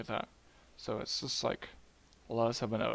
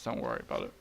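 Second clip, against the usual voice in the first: ordinary talk, nothing marked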